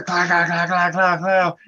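rapid clacking gun noises; imitated gunfire. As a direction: fire off clacks like gunshots. Imitates gunfire after open fire.

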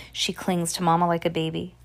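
ordinary delivery, nothing marked

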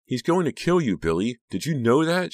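high, loud urgent shout. A narrator's reading. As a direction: shout a desperate warning. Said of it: "she shouted".

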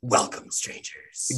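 hushed, unvoiced mock-mysterious welcome; showman gnome. Gnome shopkeeper greets strangers, hushed theatrical welcome. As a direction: drop to a stagey whisper. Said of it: "a bearded gnome leaps onto a stool".

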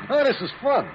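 high, gleeful taunt; gloating glee. Acted. Thug taunting the captured doctors, gleeful. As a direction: relish their helplessness.

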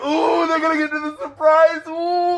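sing-song tease about a coming surprise; knowing anticipation. Retold or imitated. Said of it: "you're like".

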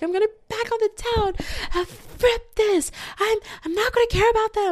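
high, fast mock voice of a friend getting over it; mock heartbroken friend. Friend mocked, vowing to hit the town. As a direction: squeak it out like a hyped-up friend. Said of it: "and then you're like".

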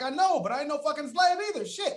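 high, laughing comeback; laughing defiance. Retold or imitated. Defiant retort while laughing.

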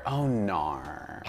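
low, soft Aussie "oh nar"; understated mock Australian "oh nar"; Australian accent. Shown as a demonstration. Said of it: "It's my favorite word".

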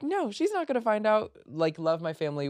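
high, light brush-off; breezy denial. Retold or imitated. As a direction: wave away the worry. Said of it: "and I was like".

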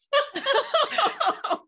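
squealing laugh, high and loud; high burst of laughter. Real, not acted. Laughing at herself with her friend, high laugh.

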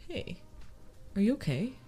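soft, tentative check-in; gentle concern. Acted. Friend checking on someone near tears, gentle concern. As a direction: ease in gently, with a pause. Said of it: "they're like".

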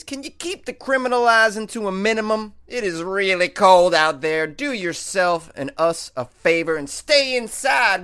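high, loud folksy cop asking a favor; police officer impression. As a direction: play a friendly small-town officer pleading. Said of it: "my impression of a Warrensburg police officer".

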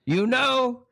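high-pitched theatrical lament; Karloff complaining. Retold or imitated. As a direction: imitate a horror star complaining to his agent. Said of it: "I said to Bernie".

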